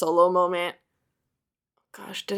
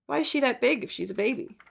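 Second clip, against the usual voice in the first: ordinary talk, nothing marked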